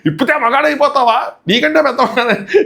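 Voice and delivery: half-laughing impression of an angry elder; mock heated retort.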